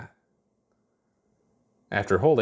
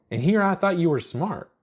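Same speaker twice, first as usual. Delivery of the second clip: put-on teasing girl voice; 9 semitones higher than usual. Chuck teasing Ponder.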